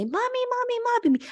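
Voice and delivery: put-on very high, pleading little child voice; child calling mommy. Children begging their mother for attention, high childlike whine. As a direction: call up to mommy like a small needy kid.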